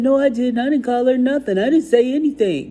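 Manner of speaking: loud, high mock protest of an accused kid; indignant denial. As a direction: act out a child's heated denial. Boy's denial in retold quarrel.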